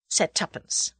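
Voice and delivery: ordinary delivery, nothing marked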